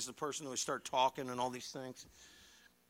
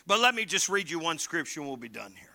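ordinary talk, nothing marked

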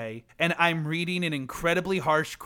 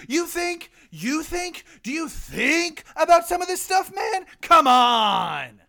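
high, strained angry shouting; hammed-up action-movie rage; furious yelling; 9 semitones higher than usual. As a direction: get right in his face. Furious crew member confronting driver.

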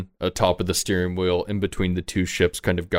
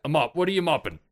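higher-pitched crewman demanding answers; challenging deckhand; 9 semitones higher, faster than usual. Acted. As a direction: confront an idle hand in a raised pitch. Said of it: "one of them walks over and he says".

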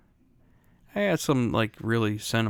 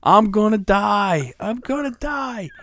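put-on high, slow wail of a scared child; panicked little kid; 10 semitones higher, 6 dB louder, slower than usual. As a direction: whimper it like a terrified five-year-old. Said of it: "And I'm saying it".

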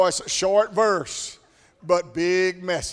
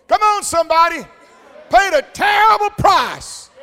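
higher and louder, shouted preaching climax; preaching at full force; 10 semitones higher, 9 dB louder than usual. Acted. Preacher pushing the congregation, shouted preaching. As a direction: call out to the church at full force.

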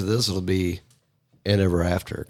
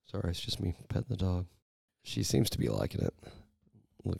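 ordinary delivery

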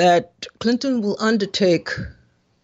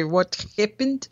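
lightly mimicked Russian accent, pitched up; imitated Russian accent; 5 semitones higher, 4 dB quieter than usual. Host asking guest about the phrase, imitating his Russian accent. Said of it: "is that a Russian accent".

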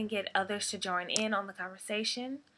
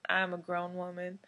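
normal talking voice

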